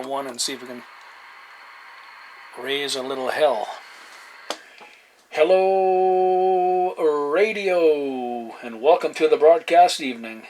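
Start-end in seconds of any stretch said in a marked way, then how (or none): 5.3-10.4 drawn-out announcer greeting over the air; radio call-out. Acted. CB operator greeting the empty channel, announcer style. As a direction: open the channel like a show host.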